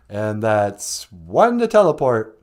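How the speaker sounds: deep, slow put-on voice; low, drawn-out sci-fi crew voice; acted teleport operator.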